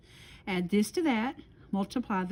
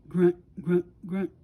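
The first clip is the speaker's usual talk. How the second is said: low voiced grunts, a touch louder; 4 semitones lower, 5 dB louder than usual. A narrator's reading. Storyteller to kids, grunting as the bear arrives. Bear approaching, sound effect.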